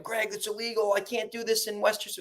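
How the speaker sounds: lightly voiced worried newbie; mock timid investor. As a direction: fret like a nervous beginner. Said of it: "they're like".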